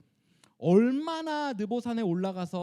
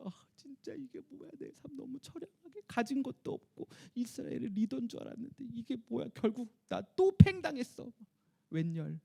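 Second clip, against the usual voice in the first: mock crying; 6 semitones higher than usual. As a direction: whine like a hurt, weepy old man. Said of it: "울면서". (서러워 울먹이는 모세 흉내)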